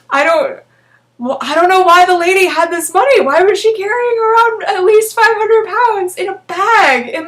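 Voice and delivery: high, loud incredulous rant; exasperated disbelief. Real, not acted. Reader ranting at her own old story, exasperated. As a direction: throw up your hands at the plot.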